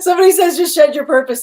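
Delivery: high, loud, cracked up while retelling; squeezed out through real laughter; still laughing. Real, not acted. Reader retelling the demand to shed your purpose, laughing.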